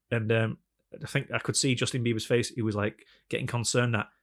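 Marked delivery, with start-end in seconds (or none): none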